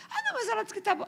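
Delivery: put-on very high, airy dismissive girl voice; mimicking the girl who shrugged off the crying woman; mock careless girl. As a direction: brush it off in a squeaky careless voice. Girl's excuse, imitated.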